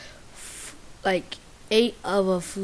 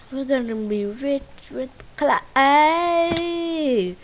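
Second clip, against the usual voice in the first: excited; 6 semitones higher, 6 dB louder, slower than usual. Real, not acted. Excited slow line.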